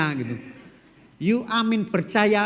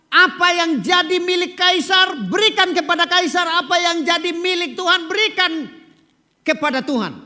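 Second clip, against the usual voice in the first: solemn scripture quote; 12 semitones higher than usual. Acted. Quoting Jesus in preaching voice.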